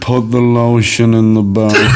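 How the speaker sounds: Buffalo Bill. Retold or imitated. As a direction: give the order as a calm film psychopath. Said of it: "Sound like Buffalo Bill from Hannibal".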